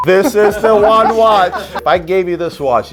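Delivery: excited. Real, not acted. Pitching watch to Nico.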